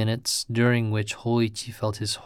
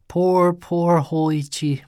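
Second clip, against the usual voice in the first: pitying exclamation; 6 semitones higher, 3 dB louder, slower than usual. A narrator's reading. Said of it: "the priest exclaimed".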